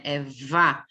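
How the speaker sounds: "vá" with the lip buzzing on the teeth; phonics demo. Shown as a demonstration. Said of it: "você vai colocar". ("vá" vibrando o lábio)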